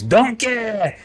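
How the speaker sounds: high mock ogre yell, pitched well up; a hammed-up monster cry; ogre scream. GM voicing the ogre crying out as it is stabbed, in a high mock yell. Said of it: "And the ogre screams".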